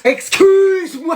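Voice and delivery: very high, slow, drawn-out excuse me; indignant. Acted. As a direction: stretch it out in outrage. Indignant girl.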